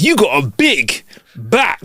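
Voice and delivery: slow, drawn-out street guy; mock catcall from a stranger in a shop; imitating the dude. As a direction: drawl it like a cheeky stranger. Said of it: "He goes".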